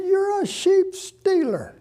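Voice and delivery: high, slow mock accusing voice; drawn out like a scolding; mock accusation. Pastor mimicking the man calling him a sheep stealer, mock accusing.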